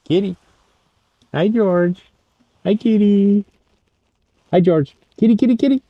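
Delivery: very high, loud kitty calling. Real, not acted. Greeting the cat.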